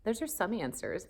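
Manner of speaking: usual speaking voice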